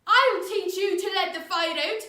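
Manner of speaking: loud, high raging father; furious threat. Acted. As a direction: threaten the boy at full voice.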